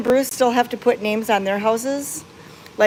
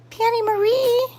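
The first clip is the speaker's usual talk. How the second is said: pet talk; 13 semitones higher than usual. Real, not acted. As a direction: coo up high to the kitty. Cooing at the cat.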